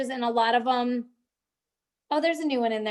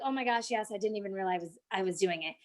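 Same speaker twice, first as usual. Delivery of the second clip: low, softer mock confession; acted sheepish admission; 6 semitones lower, 8 dB quieter, faster than usual. Admitting to Mike she was scrolling, acted sheepish. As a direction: drop it down small, as if caught out. Acting out her caught-out reply.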